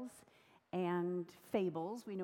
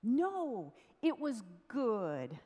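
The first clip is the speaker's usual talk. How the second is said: emphatic contradiction; 4 dB louder, slower than usual. A narrator's reading. Friend correcting the apologetic king, emphatically positive. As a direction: insist on it, stretching each key word. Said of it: "And the friend replied".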